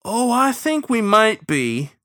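high, slow, loud hint; drawn-out, pointed correction. A narrator's reading. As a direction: subtly warn that he's outnumbered. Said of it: "in a tone which subtly drew one's attention".

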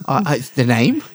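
questioning Italian waiter; high querying Italian host voice; Italian accent. Retold or imitated.

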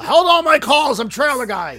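loud, high pompous boss voice; gravelly old tycoon. Acted. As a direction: play the big boss brushing off calls. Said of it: "C E O trailer guy".

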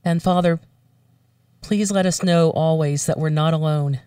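ordinary talk

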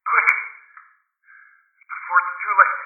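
softened but tense, high strained plea; resigned, yet anxious for the listener; hopeless resignation. A narrator's reading. Doomed man urging his friend away, tense resignation. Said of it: "acquiring a softer quality as of hopeless resignation".

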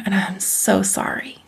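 slow soft whisper; whispered sorry. Retold or imitated. Wife to husband, a whispered slow apology. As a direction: breathe the apology out.

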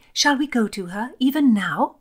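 high, pressing question; urging. A narrator's reading. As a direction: press the request eagerly. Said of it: "urged Philomena".

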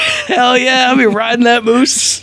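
high-pitched, drawn-out playful holler; mock excited whoop. Host imagining riding a moose, mock excited whoop. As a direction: whoop it like a gleeful moose rider. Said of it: "I was like".

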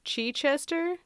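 Chichester as "chee-CHESS-ter", questioning; trying pronunciations. Shown as a demonstration. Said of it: "Is it".

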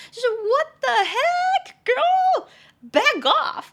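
very high, loud, drawn-out yell; outraged shout. Acted. Telling off a friend's pushy boss, high indignant shout. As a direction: yell it in hammed-up disbelief. Acted outrage at the boss's advances.

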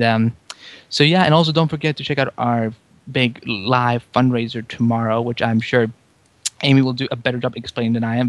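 ordinary talk

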